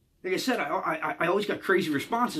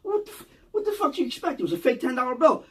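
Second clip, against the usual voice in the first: dad's blunt retort; 7 semitones higher than usual. Retold or imitated. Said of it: "the way he said it to me, he leans over and goes".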